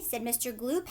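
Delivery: ordinary delivery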